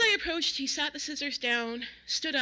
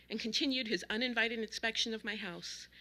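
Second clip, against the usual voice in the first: ordinary delivery, nothing marked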